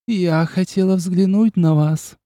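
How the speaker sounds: high, slow, languid vampire voice; Ines the vampire. Acted. Ines on the table explaining herself, languid. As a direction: purr it coolly.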